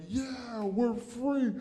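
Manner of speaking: high, soft little cheer; mock celebration. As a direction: cheer in a high, held-back voice. Acting out celebration of freedom.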